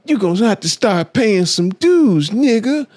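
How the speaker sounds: tough street dude. Retold or imitated. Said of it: "he was like".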